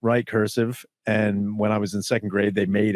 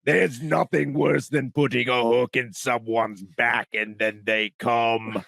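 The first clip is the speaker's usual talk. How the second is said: slow, booming mock-demon narration; Pinhead; slower than usual. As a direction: read erotica as a horror villain. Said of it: "Pinhead from Hellraiser".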